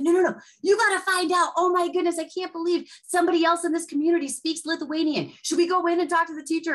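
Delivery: a father who can't believe it; excited dad. Retold or imitated. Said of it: "his dad's so excited".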